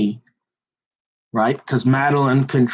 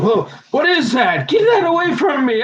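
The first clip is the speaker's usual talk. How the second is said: freaked-out shriek; 14 semitones higher than usual. Retold or imitated. Mom sees the hidden cat under the shirt, freaked-out shriek. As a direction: squeal like a startled mom. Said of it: "she freaked out".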